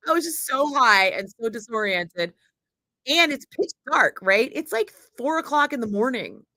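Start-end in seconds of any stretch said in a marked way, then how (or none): none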